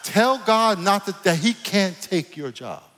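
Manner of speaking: high, loud, hyped-up preacher voice; full-force preaching. Acted. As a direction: fire it at the congregation, pitched high.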